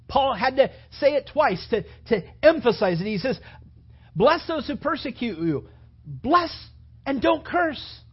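loud, high preaching with Paul's words hammered home; fiery preaching. Acted. Preacher urging the church to bless, preached at full force.

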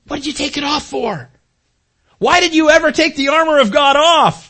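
fiery scolding. Retold or imitated. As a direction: demand to know why they took it off. Fiery preacher scolding students.

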